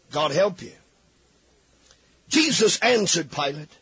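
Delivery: usual speaking voice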